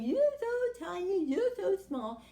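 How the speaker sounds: sing-song high teasing, slow; mock the little worm, high and drawn out; high slow taunting. Siblings taunt Herman.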